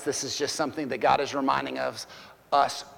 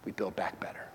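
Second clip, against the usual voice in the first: whispered echo; 8 semitones lower, 11 dB quieter than usual. Shown as a demonstration. Preacher echoing the lady's words to the church, whispering. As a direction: whisper it softly. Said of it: "repeats phrase in whisper".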